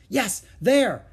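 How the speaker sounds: high, loud exclamation; excited confirmation. Acted. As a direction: cry out at the sighting. Annie spots the streak.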